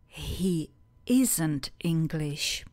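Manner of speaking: slow whisper. Shown as a demonstration. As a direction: whisper "He isn't English" slowly. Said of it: "Já odpovím". (šeptem „He isn't English“)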